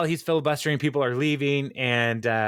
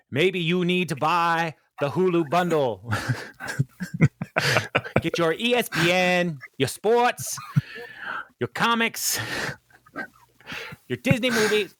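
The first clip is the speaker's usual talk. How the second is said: ad pitch; 6 semitones higher than usual. Pretend. Mock ad pitch for Hulu bundle.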